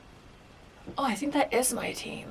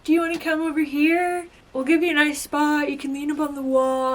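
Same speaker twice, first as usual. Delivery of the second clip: mock-sweet higher-pitched offer; polite invite; 6 semitones higher, 3 dB louder than usual. Teammates politely inviting her over at first, mock-polite. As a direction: retell a friendly, coaxing invitation in a lifted voice. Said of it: "at first, they were politely, everyone was like".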